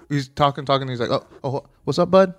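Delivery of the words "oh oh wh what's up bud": higher and louder "what's up, bud?" tossed off as a put-down; mock condescending. [name] brushing off Cody mid-rant, mock cheery and louder. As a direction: fake friendly, a jab. Mocking Cody at press conference.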